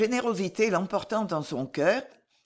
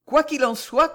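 higher, louder, pushing on with effort; 8 semitones higher, 6 dB louder than usual. A narrator's reading. Alice resumes her confession to Jeanne, with effort. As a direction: force yourself to go on. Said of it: "continua avec effort Alice de Lux".

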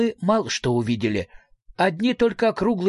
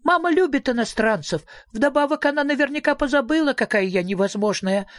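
put-on high little-girl chatter; excited child's piping explanation; delighted; 10 semitones higher than usual. A narrator's reading. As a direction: prattle happily in a small high voice. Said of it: "восхищённо воскликнула дочь Мимлы".

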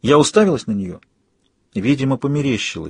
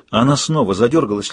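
plain reading voice

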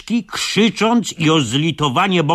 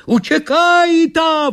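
very high, loud, slow yell; 14 semitones higher, 5 dB louder, slower than usual. Acted. Narrator yells at freed pale people to flee, loud high yell. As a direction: scream at them to run. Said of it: "wrzasnął ja do nich".

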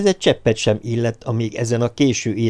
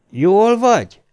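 loud, high-pitched concerned call; 10 semitones higher, 5 dB louder, slower than usual. A narrator's reading. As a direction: shout it through to someone shut inside. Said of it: "hogy a nevét kiabálják". (hangosan bekiabálva)

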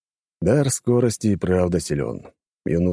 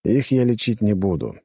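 ordinary delivery

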